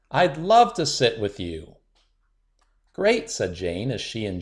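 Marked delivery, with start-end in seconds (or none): 0.1-1.8 louder, bold and clear; confident and loud. A narrator's reading. Shy girl finally speaking up to classmates, loud. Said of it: "pressed the magic microphone to her lips". 2.9-3.3 happy reply. Acted.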